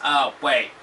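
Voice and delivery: ordinary delivery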